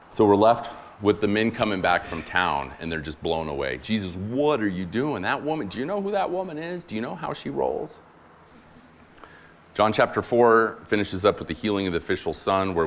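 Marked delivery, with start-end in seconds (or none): 3.8-8.0 lightly voiced disbelieving disciples, higher; a hint of scandalized followers, pitch climbing; shocked disciples. Shocked disciples confront Jesus.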